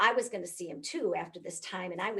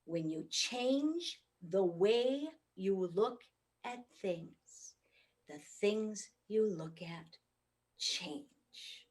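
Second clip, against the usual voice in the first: slow key message; 3 dB quieter, much slower than usual. Shown as a demonstration. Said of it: "I want you to remember this".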